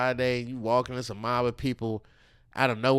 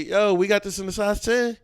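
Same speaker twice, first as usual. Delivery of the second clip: higher and louder, casual shout across the store; calling to the worker; 9 semitones higher, 7 dB louder than usual. Retold or imitated. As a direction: flag down the worker. Asking the worker for shoes.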